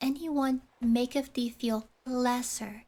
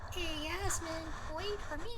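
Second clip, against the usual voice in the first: high and far off, calling out; calling from afar; 7 semitones higher, 7 dB quieter, faster than usual. Acted. Accomplice calling to Yasmin from afar, far-off call. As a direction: call after her as she leaves.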